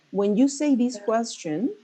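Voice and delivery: ordinary talk, nothing marked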